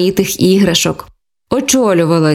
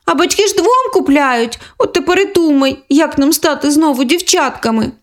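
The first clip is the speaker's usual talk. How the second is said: put-on high, childlike little girl voice; a young sister complaining; sulky little sister, pitched high; girl's voice; 8 semitones higher than usual. Girl character voices quarrel.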